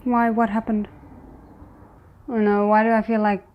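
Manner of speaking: ordinary delivery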